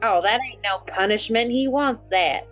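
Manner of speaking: usual speaking voice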